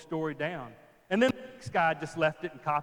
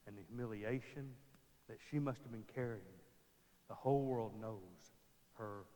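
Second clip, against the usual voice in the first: hushed sermon; 4 semitones lower, 11 dB quieter than usual. Real, not acted. Soft sermon passage.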